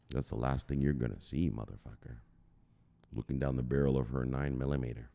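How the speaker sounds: low, soft threat; quiet ominous warning; menacing hush. Acted.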